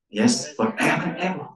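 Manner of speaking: ordinary delivery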